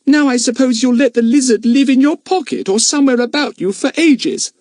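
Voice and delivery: put-on high-pitched, loud girl's voice full of disgust; a girl scolding her brother about his pets, disgusted and loud. A narrator's reading. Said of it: "said Dinah in disgust".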